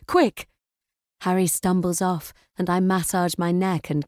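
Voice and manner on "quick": urgent shout. A narrator's reading. Urgent order to son.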